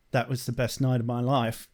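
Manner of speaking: put-on British accent, low and understated; Spike. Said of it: "doing the Spike accent".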